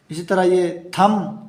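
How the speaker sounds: usual speaking voice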